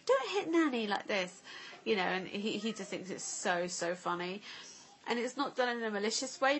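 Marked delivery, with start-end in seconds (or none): 0.1-1.4 put-on much higher, louder telling-off of a toddler; mock scolding. Parent imitating telling the boy off for hitting Nanny, higher. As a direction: play the parent warning the boy. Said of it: "we have to be like".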